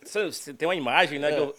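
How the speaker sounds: ordinary talk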